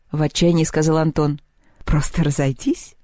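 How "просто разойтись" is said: giggling. A narrator's reading. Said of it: "захихикала Поляндра".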